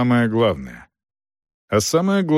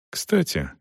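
soft, hesitant afterthought; slightly flustered; 5 semitones higher, 5 dB quieter than usual. A narrator's reading. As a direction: add it softly, a little at a loss. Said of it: "Селдон немного растерялся".

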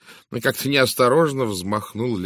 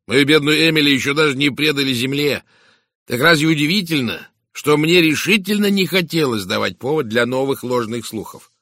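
loud, raised-pitch protest; indignant; 6 semitones higher, 7 dB louder than usual. Acted. Widower justifies his silence to inspector, indignantly. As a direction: defend yourself with loud indignation.

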